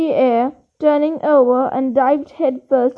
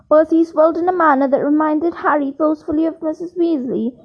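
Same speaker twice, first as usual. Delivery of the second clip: ordinary delivery, nothing marked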